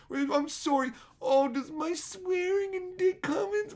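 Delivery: very high, drawn-out mocking whine; exaggerated squeaky sarcasm; mock hurt. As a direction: taunt them with a fake sorry voice. Mocks friends' objections.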